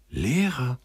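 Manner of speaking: high-pitched, wondering cry of delight; excited exclamation. A narrator's reading. Sender learns the soldier wanted to teach, thrilled. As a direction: echo it high, as if eyes light up. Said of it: "rief Sender, und seine Augen leuchteten".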